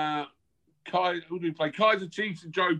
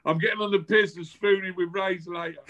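usual speaking voice

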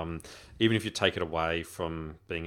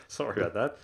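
ordinary delivery

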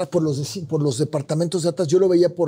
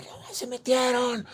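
high, drawn-out mock yell; retold scream; 9 semitones higher, 4 dB quieter, slower than usual. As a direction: imitate crying out to the neighbours, held back in volume. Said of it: "y empiezo a gritar".

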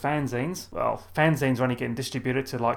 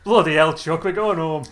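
mock northern grumble; 2 semitones higher, 6 dB louder than usual. Joining the imagined relatives' voice.

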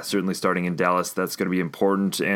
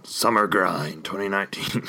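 monster truck ad. Pretend. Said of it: "Sounds like some kind of, uh, hilarious wrestling promotion".

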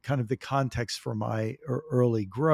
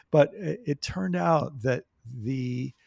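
ordinary talk

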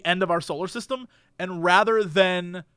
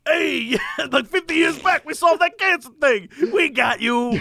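shouting alien; 5 semitones higher than usual. Pretend. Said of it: "they're like".

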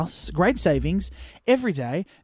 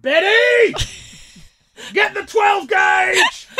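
high, drawn-out holler; imitating a full-voiced, stretched shout; nurse yelling; 11 semitones higher, 5 dB louder, much slower than usual. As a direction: bellow across a crowded hall for a colleague. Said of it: "Why is this woman yelling?".

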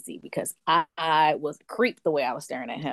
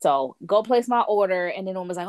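usual speaking voice

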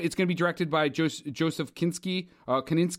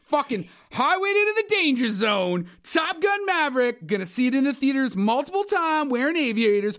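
hyped movie announcer; 9 semitones higher, 4 dB louder than usual. Acted. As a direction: sell the blockbuster with over-the-top excitement. Hyped movie pitch like a trailer.

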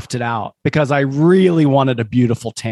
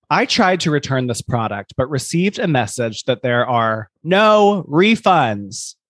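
ordinary delivery

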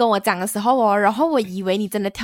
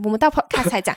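ordinary delivery

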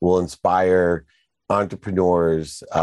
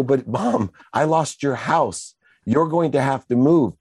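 higher voice of a distressed son, reenacted; mildly anguished retold confession, pitched up; retold pleading confession; 7 semitones higher than usual. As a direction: plead with mom to take in bad news. Son confesses to mom, acted out.

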